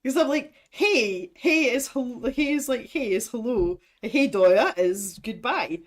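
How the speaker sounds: ordinary talk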